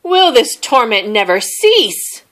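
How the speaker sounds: loud anguished wail. A narrator's reading. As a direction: wail at the ceiling in despair. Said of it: "wailed Bear".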